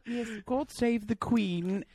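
high, slow mock British turning Australian; British-Australian mashup. Co-host mocking the accent slip, high British-Australian mashup. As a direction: proclaim it grandly in muddled accents. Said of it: "holding a teacup and the next you have a boomerang".

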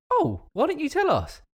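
very high-pitched retold surprise; surprised reaction. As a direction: act out delighted disbelief, pitched right up. Retells his reaction to friend's news.

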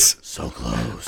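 breathy mock-eager whisper. Cohost whispering his craving for the new season. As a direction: hush it like a secret craving.

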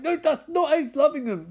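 high-pitched, insistent mock objection; frantic protest. Profiler correcting his boss, high frantic protest. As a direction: protest as if badly misunderstood.

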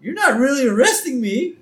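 high, loud protest; indignant disbelief. Retold or imitated. Husband to wife handcuffing him, incredulous protest. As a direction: object as it suddenly sinks in. Homer realises he is being arrested.

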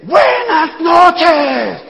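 very high, long-stretched shouted greeting; mock a presenter hollering every syllable out long; hammed-up stage greeting. Doctor friend greeting four people like a full auditorium, hammed-up shout. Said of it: "y dice".

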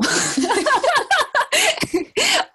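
squealing laugh; high burst of laughter. Real, not acted. Laughing at lost thought.

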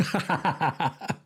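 a quick real laugh at the odd job name; short laugh. Real, not acted. Host reading out the job chick sexer, short real laugh. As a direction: laugh briefly at the joke.

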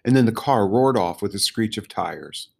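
usual speaking voice

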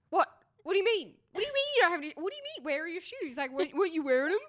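high, loud exasperated questioning; angry dad. Retold or imitated. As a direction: imitate a dad losing patience. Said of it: "got really mad at me".

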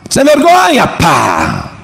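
high sharp scolding cry, then a slap; a hammed-up furious father; mock angry parent. Pastor acting an angry father scolding his pregnant daughter, mock shout.

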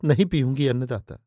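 mildly imploring, plaintive refusal; understated pleading to a master; begging. A narrator's reading. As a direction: beg to be let off, voice falling away. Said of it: "हाथ जोड़कर गिड़गिड़ाते हुए कहा".

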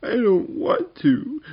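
very high, loud, slow wail; drawn-out tearful protest, way up high; crying little goblin. A narrator's reading. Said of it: "crying goblin in the back".

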